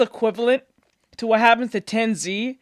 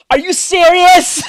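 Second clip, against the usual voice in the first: loud, high-pitched disbelief; real outraged astonishment; incredulous outburst; 5 semitones higher, 7 dB louder than usual. Real, not acted. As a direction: throw it out in exasperated shock. Incredulous at the character.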